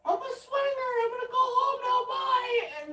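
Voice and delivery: very high, loud and hurried; flustered neighbor. Retold or imitated. Neighbor leaving after being ignored, very high and flustered. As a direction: rush it out, flustered. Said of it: "And she's like".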